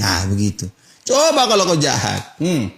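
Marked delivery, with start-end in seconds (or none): none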